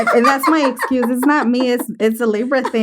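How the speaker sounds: giggly playful excuse; laughing excuse. Real, not acted. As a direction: laugh off the blame. Joking excuse about Libras.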